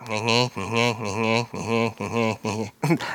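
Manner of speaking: low wordless nyeh-nyeh grumbling; mock grumbling. Shown as a demonstration. Preacher showing what grumbling sounds like, mock grumbling. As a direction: imitate a grumbler with repeated nasal nonsense noises. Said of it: "That's what grumbling sounds like".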